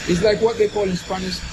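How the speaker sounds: ordinary talk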